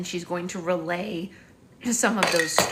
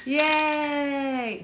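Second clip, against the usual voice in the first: cheering yay; 9 semitones higher, 4 dB louder than usual. Real, not acted. Cheering the baby.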